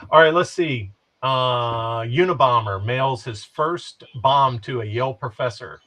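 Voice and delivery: normal talking voice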